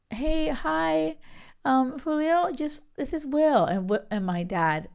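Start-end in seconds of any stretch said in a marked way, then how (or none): 0.1-3.7 imitating Will's polite phone greeting, higher; a higher, careful voice for Will calling the dad; playing Will nervously greeting the dad on the phone, voiced higher. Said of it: "Will's like".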